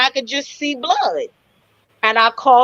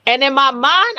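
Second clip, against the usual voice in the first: ordinary talk, nothing marked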